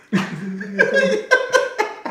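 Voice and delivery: loud shrieking laugh; loud high laugh. Real, not acted. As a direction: let out a big howling laugh.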